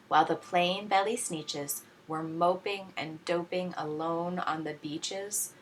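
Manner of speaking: ordinary delivery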